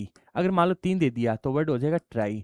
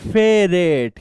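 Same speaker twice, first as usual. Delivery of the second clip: loud answer; 8 dB louder, much slower than usual. Shown as a demonstration. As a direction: punch ferret out loudly. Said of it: "क्या लिखना है?".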